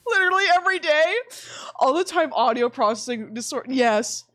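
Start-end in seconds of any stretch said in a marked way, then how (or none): none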